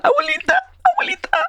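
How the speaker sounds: very high, tiny child imitation; high childlike cry for grandma; little girl voice. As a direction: squeak it like a small girl. Voices the little girl caller again.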